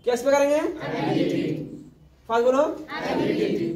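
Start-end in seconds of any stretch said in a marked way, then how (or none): 0.6-1.5 a soft unvoiced cue for the students; whispered prompt. Shown as a demonstration. Teacher whispering the answer to prompt the class. 3.0-3.7 a soft unvoiced cue for the students; whispered prompt. Shown as a demonstration. Teacher whispering the answer to prompt the class.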